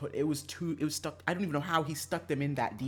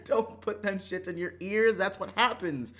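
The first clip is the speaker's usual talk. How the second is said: laughing warning; 7 semitones higher, 6 dB louder than usual. Real, not acted. Warning viewers while laughing.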